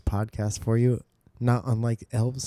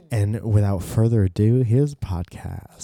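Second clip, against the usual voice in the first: announcer voice; 6 dB louder than usual. Pretend. Announcer intro.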